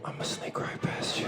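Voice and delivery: unvoiced whisper, repeated for the crowd; old lady whisper. Comic re-enacting the old lady to the crowd, whisper. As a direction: breathe it out like a sneaky granny. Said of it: "she whispered on the back of my neck".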